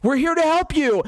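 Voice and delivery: high, sing-song fake sympathy; mock caring. Ranting host mimics colonizers' fake kindness, high and sing-song. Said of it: "but they just say".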